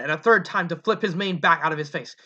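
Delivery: plain reading voice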